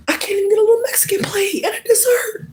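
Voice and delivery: mock whine. Comic whine over prices.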